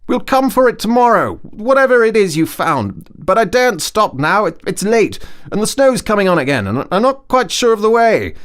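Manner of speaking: high, loud, brisk Rat voice; Rat calling back. A narrator's reading. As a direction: hurry him along, no time to stop. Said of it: "he called back".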